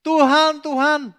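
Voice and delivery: high, loud imitated cry of Tuhan, Tuhan; hammed-up calling Lord, Lord; mock pleading cry. Mocking people calling God's name.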